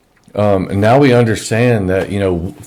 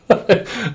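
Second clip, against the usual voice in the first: exasperated laugh; 12 semitones higher than usual. Real, not acted.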